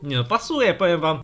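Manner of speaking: ordinary talk, nothing marked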